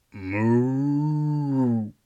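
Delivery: mock moo; lightly voiced cow. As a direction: moo like a cow, the dumb punchline. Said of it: "the Aggie goes".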